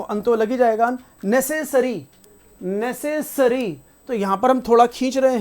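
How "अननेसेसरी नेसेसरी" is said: अननेसेसरी said very slowly with the ne stretched long; slow pronunciation demo. Shown as a demonstration. Teacher showing students the pronunciation slowly. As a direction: stretch out नेसेसरी so the e is heard. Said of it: "इसकी pronunciation देखो".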